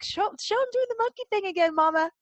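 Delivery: put-on high, quick child's plea; little girl. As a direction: beg Mama like an eager kid. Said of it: "I'll be like".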